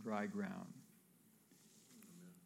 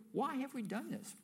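much higher, incredulous regret; dismayed Pharaoh's servants; 13 semitones higher than usual. Acted. Pharaoh's servants asking each other about the loss, incredulous. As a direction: throw up your hands at the blunder.

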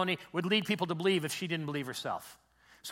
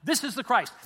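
"This is the Christ" raised and firmly stated; emphatic statement; 6 semitones higher, 5 dB louder than usual. Shown as a demonstration. Preacher showing how emphasis turns "This is the Christ" into certainty. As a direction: state "This is the Christ" as settled fact. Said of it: "It's how you put the emphasis".

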